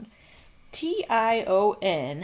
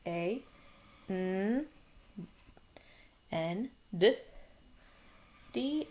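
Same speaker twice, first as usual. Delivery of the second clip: ordinary delivery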